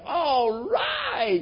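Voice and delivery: high, excited cry of joy; joyful whoop. Retold or imitated. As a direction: whoop it out like a delighted old man. Abram's joyful laugh voiced.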